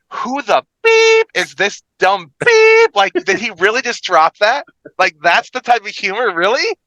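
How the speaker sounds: high, incredulous imitation of baffled co-hosts; mock disbelief. Imitating co-hosts reacting to his joke, incredulous. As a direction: act out their stunned reaction, with squealed bleeps. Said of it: "it was, like, the nervous smiles".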